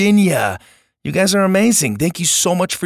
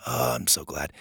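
soft aside; 8 semitones lower, 11 dB quieter than usual. Real, not acted. Touched aside to the reviewer.

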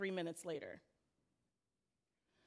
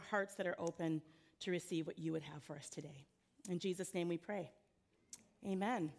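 prayer; 4 semitones lower than usual. Real, not acted. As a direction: pray gently over the congregation. Opening prayer before sermon.

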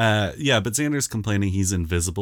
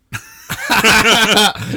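big laugh; 13 semitones higher, 9 dB louder than usual. Real, not acted. Laughing after a quoted line.

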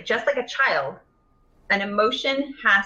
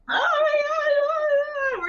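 put-on much higher, wailing little kid; crying child; slower than usual. A little kid with a skinned knee runs to mom, crying and high. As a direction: whimper it like a hurt toddler.

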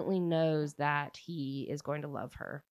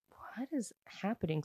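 retold bewilderment; 4 semitones higher, 8 dB quieter than usual. Newcomer baffled by strange noise.

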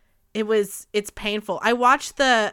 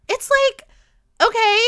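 outraged; 7 dB louder than usual. Real, not acted. Outraged reaction to the killing.

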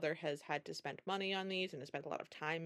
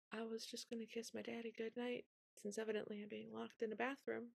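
much softer, small voice with a dry edge; meek reply; 4 semitones higher, 9 dB quieter than usual. Retold or imitated. Cindy to stepmother, soft and meek with sarcasm. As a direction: say it quietly, barely daring.

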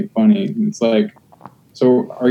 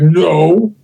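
mock crowd denial; 5 semitones higher than usual. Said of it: "everybody's like".

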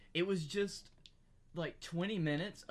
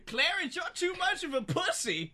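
put-on high laughing voice of a scornful old wife; cackling high old woman taunting her husband; mock old wife; 11 semitones higher than usual. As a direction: sneer at Clarence in a squeaky old lady voice. Voicing old woman mocking husband.

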